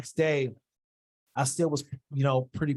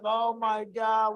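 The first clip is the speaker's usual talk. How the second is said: put-on high, slow childish wail; kid throwing a tantrum; 9 semitones higher, much slower than usual. Fighter wails to his mentor like a tantruming kid. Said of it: "like a kid, throwing a tantrum".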